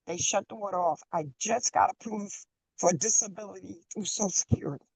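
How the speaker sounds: faint, fading phone voice; drifting away from the phone; barely audible. Real, not acted. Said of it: "you keep fading in and out".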